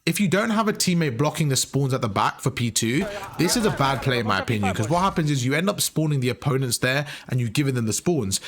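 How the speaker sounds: normal talking voice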